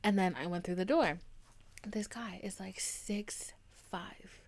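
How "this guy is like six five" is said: whispered like sharing a secret; confiding whisper to a friend; gossipy whisper. Real, not acted. Gossiping about the hot guy.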